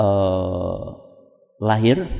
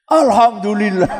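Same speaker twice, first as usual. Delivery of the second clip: exclaimed thanks from a relieved father; suami lega; 4 dB louder than usual. Acted. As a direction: burst out in mock relief. Suami lega anaknya ternyata perempuan. (seruan syukur yang lega)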